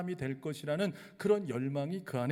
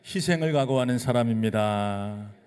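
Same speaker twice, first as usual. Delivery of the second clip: loud unison recital; 3 semitones lower, 8 dB louder than usual. Shown as a demonstration. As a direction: read aloud with the congregation, loud and measured. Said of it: "한번 따라하겠습니다".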